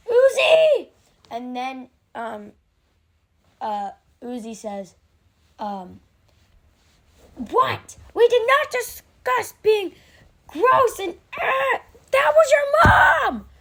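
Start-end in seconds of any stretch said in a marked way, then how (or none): 0.1-0.9 high, loud acted yell; high shouted call. Fan voicing a character calling Uzi, high yell. Character cries out Uzi's name. 7.4-13.5 high-pitched acted outburst, building to a shout; outraged character. Fan voicing Uzi's protest, high shouted outburst.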